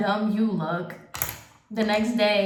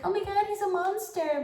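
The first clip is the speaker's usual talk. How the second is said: much higher, mock-shocked gasp; mocking outrage; 11 semitones higher, 4 dB quieter than usual. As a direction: parody the crowd's gasping horror. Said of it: "everybody's like".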